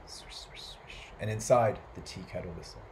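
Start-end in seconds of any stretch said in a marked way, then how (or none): 0.1-1.1 soft unvoiced "shh" swishes; swishing leaves. A narrator's reading. Storyteller imitating leaves falling on the roof, hushed swishing. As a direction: imitate rustling leaves in short hushed bursts. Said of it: "The leaves fell on the roof".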